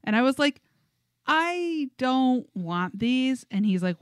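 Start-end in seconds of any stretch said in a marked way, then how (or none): 1.3-3.4 drawn-out, very slow refusal; slow, deliberate refusal of an unwanted gift. Retold or imitated. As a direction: stretch each word to refuse.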